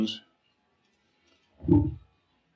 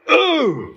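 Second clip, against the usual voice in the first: awed ooh. Acted. Blasting moment of awe.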